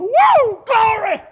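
high, loud joyful holler of glory; shouting glory. Retold or imitated. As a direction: let out a big church shout. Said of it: "And he went".

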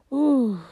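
high, loud "ooh"; struck exclamation. Real, not acted. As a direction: let out a sudden high whoop. Reacting to her own striking line.

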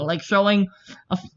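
usual speaking voice

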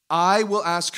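loud, deliberate verse reading; slow reading. Shown as a demonstration. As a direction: spell out the verse slowly and loudly. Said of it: "read it really slow".

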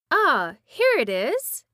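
put-on old witch finding the magic powder, overjoyed; joyful cry. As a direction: crow it out with joy at the find. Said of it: "she cried joyfully".

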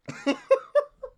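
a sudden squeaky laugh breaking out; real helpless laugh; high burst of laughter. Real, not acted. Vlogger laughing at a disgusting order, high squeaky laugh.

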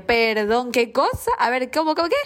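incrédula, voz cada vez más aguda; incredulidad. Retold or imitated. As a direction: como si no pudiera creer lo que oye. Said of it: "Yo casi me voy para atrás". (sorpresa incrédula subiendo de tono)

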